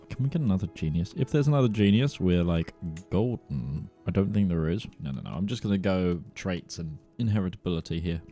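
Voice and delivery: normal talking voice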